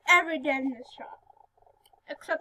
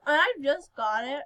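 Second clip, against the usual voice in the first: ordinary talk, nothing marked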